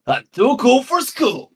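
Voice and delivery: high, slow cartoon hedgehog voice; Sonic impression. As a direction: put on a cocky cartoon hero voice. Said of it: "Sonic the Hedgehog has said".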